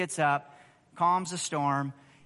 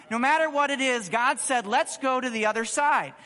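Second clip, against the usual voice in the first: bold declaration of faith; 5 semitones higher than usual. Pretend. Preacher urging believers to rise up and declare faith, bold proclamation. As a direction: rise up and proclaim it with full force.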